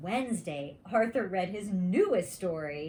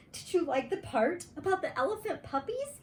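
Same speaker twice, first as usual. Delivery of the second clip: put-on quick, high, eager boy asking; eager question; 10 semitones higher, faster than usual. A narrator's reading. Arthur eagerly asking Buster about his elephant story. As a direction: rush it out hopefully.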